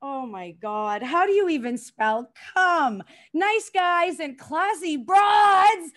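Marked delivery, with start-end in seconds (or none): 3.3-5.9 slow, louder, mock-grand declaration; grand pronouncement. Comic proclaiming to the crowd, slow and mock-grand. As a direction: announce it like high society.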